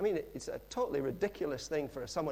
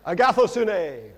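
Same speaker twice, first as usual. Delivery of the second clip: agathosune, loud, slow and sure; said with confidence; 3 semitones higher, 14 dB louder, slower than usual. Shown as a demonstration. Preacher to congregation, mock-confident agathosune. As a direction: proclaim agathosune boldly, slowly. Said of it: "as long as you say it with confidence".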